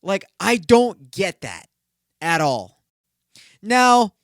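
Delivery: really worked up; loud fed-up rant to viewers; fired up. Real, not acted. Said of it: "fired-up aside".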